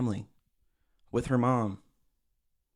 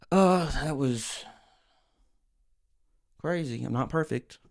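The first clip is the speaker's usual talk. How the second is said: dismissive mock apology; 3 semitones higher than usual. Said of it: "accountability, from her point of view, is saying".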